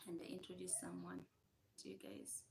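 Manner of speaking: soft fast whisper. Real, not acted. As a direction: drop to a whisper. Repeats ask in a whisper.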